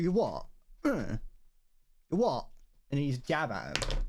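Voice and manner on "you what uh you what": brash chav "You what?", rising and jabbing; mock chav challenge. Mocking a chav pulling out his skate, brash. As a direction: puff up and jab the words. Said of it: "you go".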